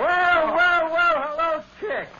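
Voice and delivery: high, loud, gushing hello; effusive greeting. Acted. Vincent greeting the cowboy star, high and loud. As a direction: gush over the guest like a showman.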